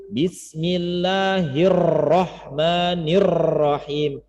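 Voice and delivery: "Bismillahirrahmanirrahim" with a heavy rolled Indonesian r. Shown as a demonstration. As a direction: chant it, rolling the r hard. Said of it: "itu Indonesia banget". (R gaya Indonesia banget)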